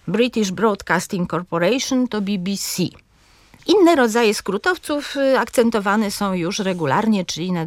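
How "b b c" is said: "B B C" as bi-bi-SI; stress last syllable. Shown as a demonstration. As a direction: lean on the final letter of "B B C". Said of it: "tak samo jest". („B B C” z akcentem na SI)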